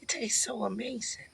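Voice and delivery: whispered, as if savoring a forbidden taste; dreamy whisper. Pretend. Defending her seasoning to the host, in a dreamy whisper. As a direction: breathe it like a guilty pleasure. Savoring Lawry's against partner's lecture.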